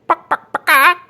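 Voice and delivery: high loud squawking clucks; chicken clucking. A narrator's reading. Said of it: "flapped their arms like chickens and clucked".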